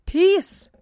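sharp, loud, high exclamation; cried out. A narrator's reading. Godfather cuts off the farmer, crying out. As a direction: cry out loudly to silence someone. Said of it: "cried the godfather".

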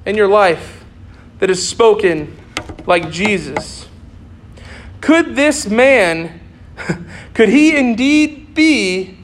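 normal talking voice

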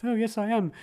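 high, airy spirit voice answering; a dead woman speaking from far away, pitched high; Tanith in trance. Retold or imitated. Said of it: "Tanith speaks through her".

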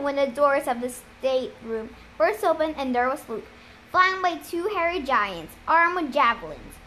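ordinary delivery, nothing marked

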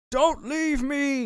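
high, slow, drawn-out wail; wailing after the fleeing Tommy, hammed up; mock Wake plea. A friend retelling the film, Wake begging Tommy, high hammed-up wail. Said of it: "And he's like".

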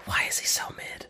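hushed whisper. Real, not acted. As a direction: whisper it like a secret aside. Joking aside about the character.

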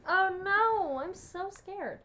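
high, drawn-out mock fear; loud, badly faked fright, slowing at the end; fake scared. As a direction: play scared, obviously insincere. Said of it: "That sounded so fake".

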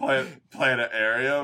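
slow planetarium with the T missing; speech impediment. Retold or imitated. Co-host imitates cartoon guy, slow planetarium without T. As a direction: stutter planetarium, leaving out the T. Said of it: "the T sound in planetarium".

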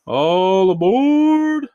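loud, high, long-drawn call; conductor's call. A narrator's reading. Conductor calls to passengers at the station, drawn-out call. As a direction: stretch it out like a train conductor. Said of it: "the conductor called".

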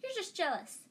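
put-on high-pitched little-boy retort; small schoolboy piping up indignantly; piping Colin. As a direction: pipe it up high and quick. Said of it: "piped up Colin".

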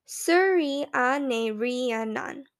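"Suri a ne ri a nan" slow, every syllable spelled out; slow it down so she can follow; said slowly. Retold or imitated. Boy patiently teaching the teacher his surname, slowly. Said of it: "I say slowly".

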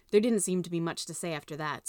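ordinary delivery, nothing marked

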